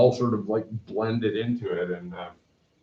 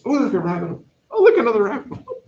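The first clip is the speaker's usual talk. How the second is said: higher and getting faster, gleefully sidetracked; mock distracted delight; 12 semitones higher, 5 dB louder, much faster than usual. Teacher joking about rabbit holes, higher and faster mock delight. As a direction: chase each new shiny thing, building up.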